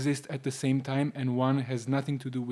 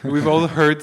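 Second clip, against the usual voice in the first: louder, laughing playful telling-off; laughing tease; 2 semitones higher, 8 dB louder than usual. Real, not acted. Panelist teasing a co-panelist about outing Ronaldo, laughing and louder. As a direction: laugh it off while scolding a friend.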